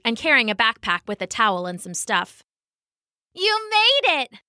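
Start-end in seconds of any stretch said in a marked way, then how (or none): 3.3-4.4 high, slow, stretched-out welcome; delighted cry. A narrator's reading. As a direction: cry out happily to an arriving friend. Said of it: "cried Isabella".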